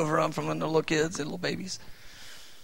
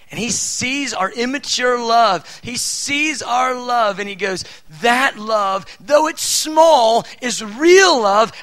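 higher and louder, slow and emphatic; preacher swelling with conviction, loud and slow; passionate preaching; 6 semitones higher, 8 dB louder, slower than usual. Real, not acted. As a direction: build to a fervent proclamation. Preaching at full force.

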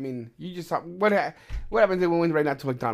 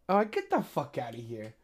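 dismissive scoff. Real, not acted. Dismissing the customer.

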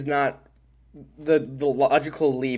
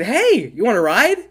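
a hint of a bright teacher greeting, higher and louder; chirpy teacher offering a ride; friendly teacher call, pitched up; lightly voiced teacher; 8 semitones higher, 6 dB louder than usual.

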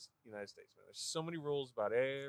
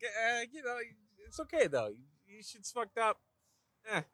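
raised, lightly voiced dopey doctor; breezy mock bedside manner, pitched higher; imitating the Idiocracy doctor; 9 semitones higher than usual. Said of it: "it's the doctor".

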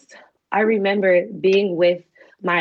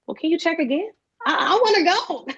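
pleading; 7 semitones higher than usual. Retold or imitated. Begging counselor to reconsider.

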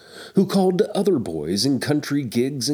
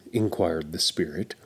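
ordinary delivery

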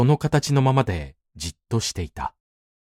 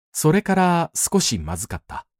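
ordinary delivery, nothing marked

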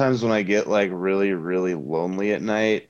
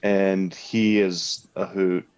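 ordinary talk

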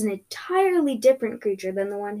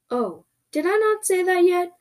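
higher-pitched innocent creature voice; creature's offhand surprise; 9 semitones higher than usual. Acted. Creature replying to a shouted question, innocent and high. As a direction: feign innocence, pitch raised.

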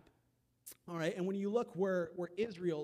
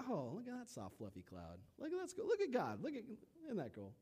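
mock wonder; 4 semitones higher, 5 dB quieter than usual. Mock wonder at fluffy cloud.

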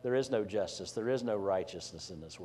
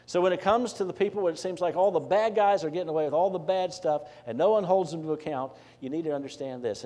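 higher, louder pulpit exhortation; preaching at full force; 7 semitones higher, 6 dB louder than usual. Acted. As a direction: urge the congregation, voice lifted.

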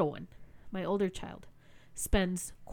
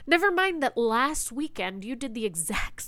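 usual speaking voice

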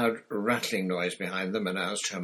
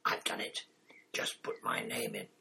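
whispering; 11 semitones lower, 6 dB quieter than usual. Acted. Angelina confides entering tournament.

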